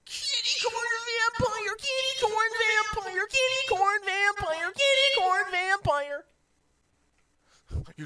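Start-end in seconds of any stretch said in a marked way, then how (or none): 0.0-6.2 shrieking call. Acted. Repeated shrieking creature name.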